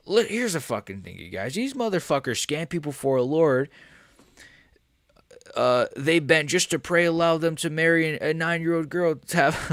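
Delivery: high, pushed trash-talker rant; Sean Strickland. Acted. Streamer retrying a fighter's trash talk, high ranting impression. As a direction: launch into an exaggerated fighter's tirade. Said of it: "okay, let me try this again".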